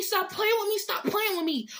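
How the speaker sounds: ordinary delivery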